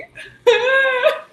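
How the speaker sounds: loud high-pitched laugh; high burst of laughter. Real, not acted. Laughs at own line.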